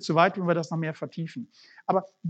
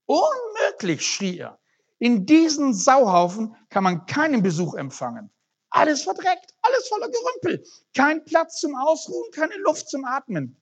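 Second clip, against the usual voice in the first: much higher, frantic panicked rant; frantic outcry; 10 semitones higher, 4 dB louder than usual. Acted. Man sees his filthy house, high frantic outcry. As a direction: shriek in horror at the messy house.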